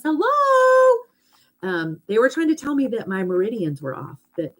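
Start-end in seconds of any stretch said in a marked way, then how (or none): 0.0-1.0 high, drawn-out mock holler; imitated yelling. Sore muscles yelling for her attention, mock holler. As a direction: yell it out high and long, building. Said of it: "yelling at the top of their lungs".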